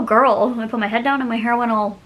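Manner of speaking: normal talking voice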